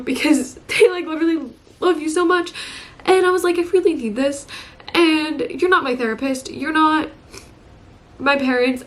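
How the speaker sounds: high-pitched, close to tears; getting emotional. Real, not acted. Vlogger explaining why she needs her pet, emotional and high-pitched. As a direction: plead from real upset, voice climbing high. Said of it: "Sorry for getting all emotional".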